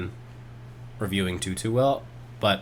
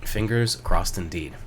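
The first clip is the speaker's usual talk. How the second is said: ordinary delivery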